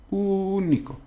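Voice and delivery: Único said very high with the Ú exaggerated; overpronounced. Shown as a demonstration. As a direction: overdo the Ú of Único. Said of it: "really overpronounce it".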